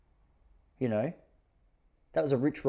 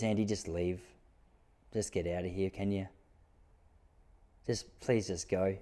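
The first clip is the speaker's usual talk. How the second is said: weary mum; 4 semitones lower, 7 dB quieter than usual. Retold or imitated. Mum sending Andy away.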